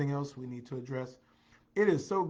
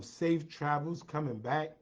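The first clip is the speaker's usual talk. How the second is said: ordinary delivery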